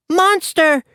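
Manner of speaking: put-on high startled child's cry; frightened toddler. A narrator's reading. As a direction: point and cry out like a scared toddler. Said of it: "he said".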